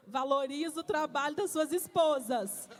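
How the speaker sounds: loud, slow, insistent preaching; emphatic plea. Real, not acted. Preacher urging husbands in the church, loud and slow. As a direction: drive it home to the husbands, slowly and loudly.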